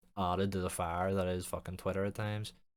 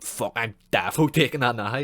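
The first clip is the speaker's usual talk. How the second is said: loud, keen, hammed-up fan of the idea; mock eager believer; 4 semitones higher, 13 dB louder than usual. Mocking a gullible commenter, much louder and excited. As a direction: yell it like you can't wait to try it.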